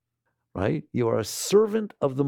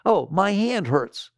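slow mock complaint; 4 semitones higher, slower than usual. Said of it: "the way you describe things".

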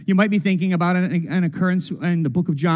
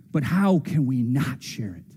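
ordinary delivery

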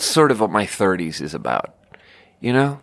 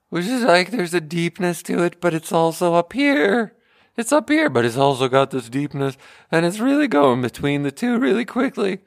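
put-on much higher, cracking teen Morty voice; 10 semitones higher than usual. Shown as a demonstration. The comic demonstrates the cartoon teenager, much higher cracking Morty voice. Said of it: "impersonation of Morty".

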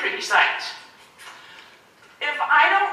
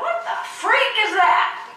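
baffled outrage; 9 semitones higher, slower than usual. Retold or imitated.

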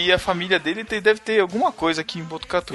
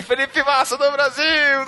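sports announcer cheer; 10 semitones higher, slower than usual. Retold or imitated. Coordinator cheering in the avenue.

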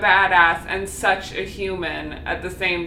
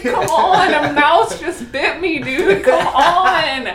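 laughing and high, louder, mock outrage; laughing exasperation; 7 semitones higher, 6 dB louder than usual. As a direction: laugh through it, fed up but amused. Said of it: "she'd be like".

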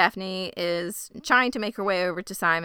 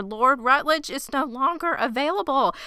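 dramatic higher announcement; 6 semitones higher than usual. Acted. Mother delivering bad news.

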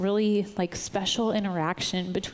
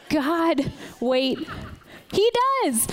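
much higher and louder, laughing with glee; delighted squeal; 8 semitones higher, 4 dB louder, slower than usual. Real, not acted. Speaker telling the congregation God has jelly, squealing with delight. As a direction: burst out in giddy joy at the surprise. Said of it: "delighted realisation, laughing".

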